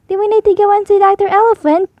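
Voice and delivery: fast, high, anxious question; worried. Acted. Peppa worried she must see the dentist. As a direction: rush it out worried.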